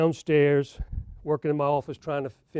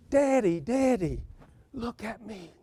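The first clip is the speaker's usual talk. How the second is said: put-on a light touch of a small girl pleading; little daughter; 4 semitones higher than usual. Eight-year-old daughter on his lap begs Daddy to look at her, lightly voiced child. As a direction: call him like a child wanting attention.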